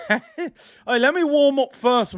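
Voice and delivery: high-pitched, laughing plea for time; laughing protest. Real, not acted. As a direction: laugh through it as you stall. Laughs off request to sing.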